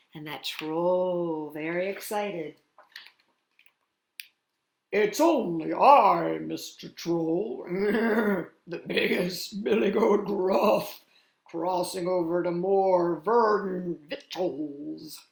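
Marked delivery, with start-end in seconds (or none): 4.9-15.2 loud, slow, hearty big-goat voice; big confident storybook goat; biggest billy goat. A narrator's reading. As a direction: boom it out with a growling chuckle. Biggest goat answers the troll.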